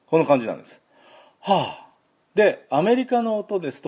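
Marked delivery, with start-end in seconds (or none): 1.4-2.4 demonstrated sigh, higher in pitch; tired sigh. Shown as a demonstration. Teacher modelling the vowel of heart, tired sigh. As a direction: sigh out from the back of the throat. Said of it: "はぁと息を吐きますよね".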